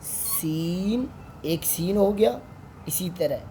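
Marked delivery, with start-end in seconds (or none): 0.0-1.1 सीन with a very long held ee; drawn-out seen. Shown as a demonstration. Teacher showing pupils a long drawn-out सीन. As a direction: stretch सीन out slowly, long ee. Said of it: "जिसे हम कहते हैं".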